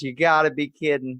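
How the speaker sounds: loud, high Southern announcer cry. Retold or imitated. Quoting the announcer from the video, loud Southern exasperation. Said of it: "but he goes".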